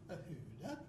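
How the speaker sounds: nonsense Swedish chant, last hoo jumping high; mock Swedish oy vey. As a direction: chant it with a laugh, ending in a squeak. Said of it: "you go like this".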